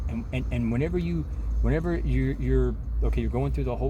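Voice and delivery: ordinary delivery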